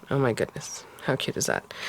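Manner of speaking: soft breathy whisper; whispered coo. Real, not acted. Whispering delight at her own craft piece. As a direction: hush it out, delighted. Cooing over the finished face.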